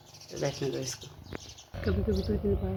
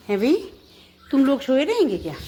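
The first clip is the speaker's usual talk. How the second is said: high, loud wake-up call; rousing pet-talk; 7 semitones higher, 8 dB louder than usual. Real, not acted. Owner rouses her sleeping dogs, high loud pet-talk. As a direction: rouse the sleepyheads with a raised lilt.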